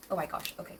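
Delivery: ordinary talk, nothing marked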